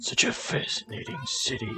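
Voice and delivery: imitating a smug anime villain; anime rival. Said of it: "He sounds like an anime villain".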